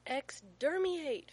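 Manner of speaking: put-on high, loud, drawn-out child's cry; slow, jubilant little-boy battle cry; jubilant yell. A narrator's reading. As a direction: crow it out like a triumphant toddler. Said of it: "Finn yells jubilantly".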